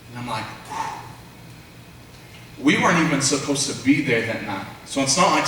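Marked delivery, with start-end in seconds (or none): 0.4-1.0 unvoiced exhaled whew; astonished whew. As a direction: blow out a breath in amazement. Reacting to the prophecy he received.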